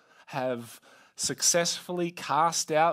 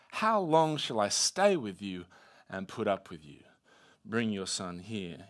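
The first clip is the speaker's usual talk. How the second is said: frustrated Jesus; 6 semitones lower than usual. Acted. Jesus asking how long he must put up with them, mildly frustrated. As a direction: start firm, fade to low weariness. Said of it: "Jesus is clearly frustrated".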